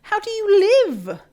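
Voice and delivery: high, loud incredulous questions; disbelieving outburst. Retold or imitated. Baffled at people without kettles, high loud disbelief. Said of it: "And I'm like".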